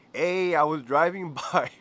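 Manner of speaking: can't get through the quote for laughing; reading Greñas's text, cracking up. Retold or imitated. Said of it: "it says".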